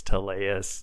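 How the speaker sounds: Tallius as tuh-LAY-us; pronunciation variant. Shown as a demonstration. Guest shows another way people say it, Tallius as tuh-LAY-us. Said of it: "people pronounce it all over the place".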